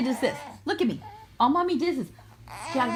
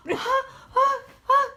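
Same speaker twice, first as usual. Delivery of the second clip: sneeze build-up; 11 semitones higher, slower than usual. Pretend.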